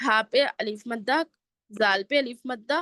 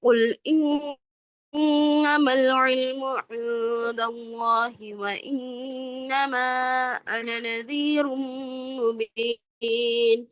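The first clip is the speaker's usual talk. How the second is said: very slow, drawn-out chanted recitation; a teacher modelling recitation for students; Quranic recitation; much slower than usual. Shown as a demonstration. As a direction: chant each word slowly with long elongations. Teacher recites the verse melodically.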